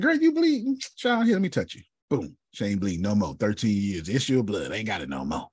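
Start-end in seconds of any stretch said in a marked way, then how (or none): 0.0-1.8 high, bright voicing of Jesus calling out; a preacher voicing Jesus, high and eager; Jesus voiced. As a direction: lift the voice high as Jesus reaching out to her. Jesus voiced healing Tracy.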